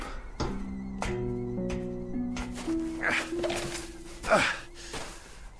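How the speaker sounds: long, drawn-out breathy sigh; exhausted sigh. Acted. Stranded captain waiting till he wakes up, exhausted sigh. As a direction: sigh heavily, worn out.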